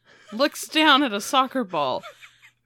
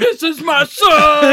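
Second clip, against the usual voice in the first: loud, high, drawn-out proclamation; mock proud shout; 5 semitones higher, 10 dB louder, slower than usual. Dad playing fetch with his dog son, mock proud shout. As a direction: bellow it slowly with hammed-up pride.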